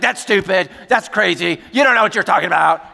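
loud, high, heated mock outburst; angry retort. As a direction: fire back insults at volume. Acting out son's angry quarrel.

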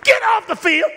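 high, loud yell at the ref; shouting mom. Retold or imitated. Soccer mom yelling at the referee, shouting. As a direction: holler like an outraged soccer mom. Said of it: "this little mama said".

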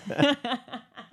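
shared laughter. Real, not acted. Both laugh at joke.

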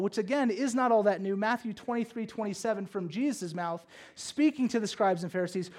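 usual speaking voice

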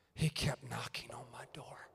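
hushed, intimate whisper. Real, not acted. Preacher confiding to congregation, soft whisper. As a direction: whisper it close and tender.

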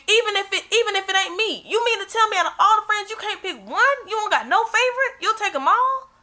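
high-pitched, disbelieving questioning; incredulous challenge. Real, not acted. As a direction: press them with rising disbelief. Challenging friend's lack of standards.

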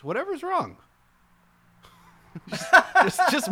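mock high squeak. Playful high exclamation.

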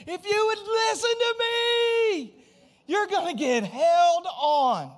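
high, loud, slow warning cry; Solomon's warning shout. Retold or imitated. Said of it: "He's like".

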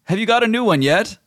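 high, fussy genie voice. Acted. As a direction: whine it like a picky genie. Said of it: "And he says".

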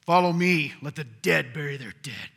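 harsh loud stern Jesus as people imagine it; harsh command. Shown as a demonstration. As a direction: snap it at him like a cold order. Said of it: "I don't think he said it this way".

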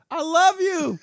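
loud, high mock cry of I love you; hammed-up high farewell cry; imitating the woman. Describing the video's ending, loud high mock cry. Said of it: "you just hear".

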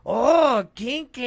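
high, drawn-out delighted coo; hammed-up naughty delight; movie character impression. Retelling the beeper scene, high cheeky impression. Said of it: "And he's like".

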